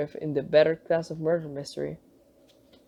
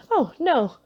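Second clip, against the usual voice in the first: quick raised protest, rushed out; hurried; 8 semitones higher, 4 dB louder than usual. Acted. Nun hastily correcting the father, hurried. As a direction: rush to correct him, a touch higher. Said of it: "said Sister Mary hurriedly".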